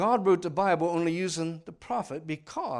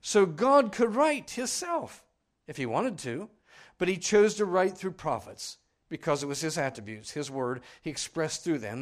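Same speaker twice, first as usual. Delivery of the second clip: forceful preaching; 6 dB louder than usual. Acted. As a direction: preach it from the pulpit.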